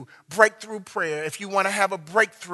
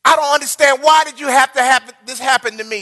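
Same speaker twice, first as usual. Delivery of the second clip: rising from hushed to a loud, higher plea; anguished prayer; 4 semitones higher, 9 dB louder than usual. Acted.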